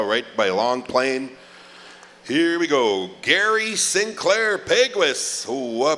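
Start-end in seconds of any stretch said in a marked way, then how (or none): 3.2-5.5 much higher and louder, names stretched slowly; drawn-out name call. Acted. MC summoning the next honoree, big slow call. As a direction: ring the name out over the arena.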